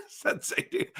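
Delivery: high squealing laugh into a breathy word; losing it laughing. Real, not acted. Streamer cracking up at a chat comment, high laugh. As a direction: crack up helplessly. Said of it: "I'm losing my mind in laughter".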